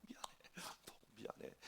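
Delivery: whispered, acted-out sorry; repeated apology. Pastor consoles a crying member, whispered apology. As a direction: breathe out the apology very softly. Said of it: "미안한 얘기를 몇 번씩 했어".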